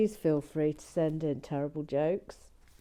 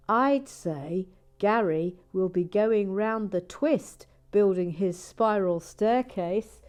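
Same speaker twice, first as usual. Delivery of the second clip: quoting Noreen's joke; 2 semitones higher, 7 dB louder than usual. Retold or imitated. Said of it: "She says".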